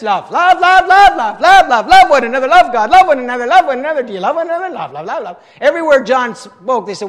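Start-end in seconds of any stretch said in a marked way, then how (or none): 0.0-5.4 high, loud, relentless run-on; hammed-up repetition. Preacher mimics John repeating his message, comically relentless. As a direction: pile them up breathlessly like an obsessed messenger.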